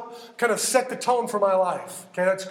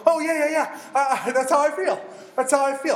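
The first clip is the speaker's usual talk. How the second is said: higher, animated agreement; acted burst of relating to the words; eager recognition; 6 semitones higher, 3 dB louder than usual. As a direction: chime in keenly, voice pitched up. Acting a reader relating to Psalms.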